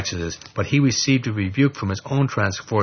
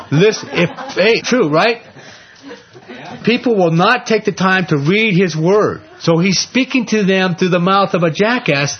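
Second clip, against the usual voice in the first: high, loud, fervent preaching; preaching at full force; 8 semitones higher, 4 dB louder than usual. Real, not acted. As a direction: rouse the congregation, full voice.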